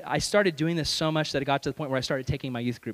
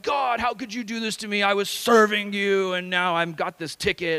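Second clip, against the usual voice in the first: high, aggrieved mock wail at God; blaming; 5 semitones higher, 3 dB louder than usual. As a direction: protest bitterly, starting high and easing down. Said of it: "I'm blaming God".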